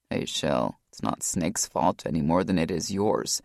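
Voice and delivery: steady her gently; brother's voice. A narrator's reading. Brother speaking.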